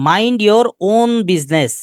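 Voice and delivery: slow, drawn-out scolding Mind your own business; scolding retort. Shown as a demonstration. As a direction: scold someone slowly, word by word. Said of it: "প্রায় প্রায় কাউকে ধমক দিয়ে বলি". (ধীরে ধমকের সুরে বলা)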